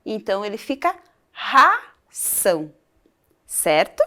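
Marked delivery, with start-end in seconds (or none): 1.3-2.7 RAÇÃO opening on a strong, H-like R. Shown as a demonstration. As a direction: split RA-ÇÃO, with an aspirated first R. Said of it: "ele tem um som mais forte".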